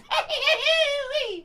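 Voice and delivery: high cackle. Acted. Swamp guide cracks up at own pitch, squealing cackle.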